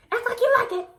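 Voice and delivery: high, loud bossy mom; mom's order. Retold or imitated. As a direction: command it like mom.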